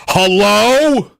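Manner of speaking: a suspicious neighbor calling into the dark; Karen calling out. Retold or imitated. Neighbors mocking his midnight yell. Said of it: "people being like".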